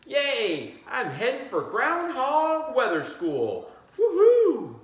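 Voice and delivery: high, loud, laughing whoop; overjoyed cheer. A narrator's reading. Teacher voicing the groundhog's joy at school, high and loud. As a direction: shout for joy.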